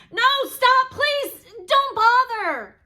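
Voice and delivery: high, loud no, slowing at the end; alarmed refusal. Acted. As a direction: cut him off before he fetches it. Child refuses the whale.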